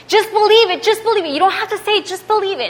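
high, insistent retold pleading; preaching at him. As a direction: imitate her urging him to believe. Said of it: "preaching at him".

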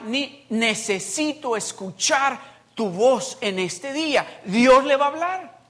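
loud preacher's promise, pressing each phrase; fervent preaching. Acted. Pastor promising his church God will speak, loud preaching. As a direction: drive the guarantee home to the church.

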